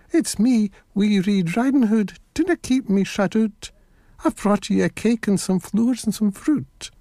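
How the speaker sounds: high, lightly voiced little girl; little girl's voice. A narrator's reading. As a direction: speak up as the real wee girl, not squeaky.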